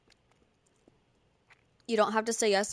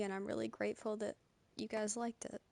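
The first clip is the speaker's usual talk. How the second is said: ordinary talk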